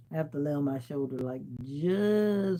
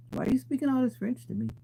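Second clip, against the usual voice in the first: much higher, fast mock complaint; mock exasperation; 11 semitones higher, 3 dB louder, faster than usual. Real, not acted. To French commenters, a high fast mock complaint. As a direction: squeal the complaint in fun.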